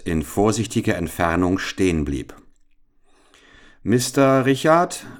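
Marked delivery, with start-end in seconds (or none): 3.8-5.1 called out louder and higher; calling out. A narrator's reading. Boy calling to the sergeant: a called-out name. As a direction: call across the valley. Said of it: "rief er".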